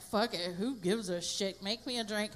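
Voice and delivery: low, soft, offhand shrug. Retold or imitated. Unconcerned mom amid a panicked neighborhood, low and indifferent. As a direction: toss it away quietly, unbothered. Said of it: "I just didn't care".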